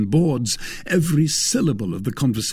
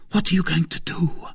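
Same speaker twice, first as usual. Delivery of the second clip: high, urgent woman's question; alarmed cry; 8 semitones higher than usual. A narrator's reading. Woman stopping man with drawn sword, alarmed. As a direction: cry out in alarm without real volume. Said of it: "cried Madame Bonacieux".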